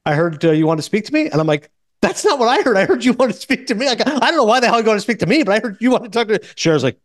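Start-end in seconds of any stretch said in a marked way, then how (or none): 2.0-6.4 high-pitched, flustered retort; retold flustered comeback, high throughout; flustered protest. Giamatti flustered, answering Cher on phone.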